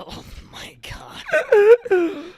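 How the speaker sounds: high breathless laughing gasp; laughing disbelief. Real, not acted. Friend reacting to Jar Jar claim, laughing breathlessly. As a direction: gasp it out while cracking up.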